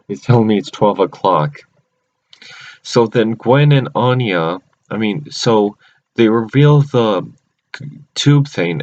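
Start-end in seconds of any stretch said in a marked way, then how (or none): none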